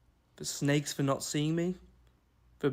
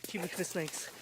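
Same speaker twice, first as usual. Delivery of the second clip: ordinary delivery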